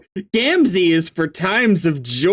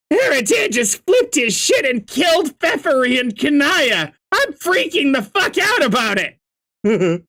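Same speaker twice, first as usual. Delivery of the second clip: panicked shout; 9 semitones higher than usual. Acted. Panicked news to friend.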